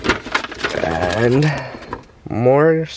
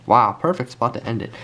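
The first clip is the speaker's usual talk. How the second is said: ordinary talk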